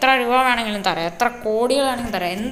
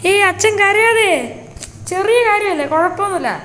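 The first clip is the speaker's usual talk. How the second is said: son pleading loudly; 9 semitones higher, 8 dB louder than usual. Acted. Son begs father not to cry.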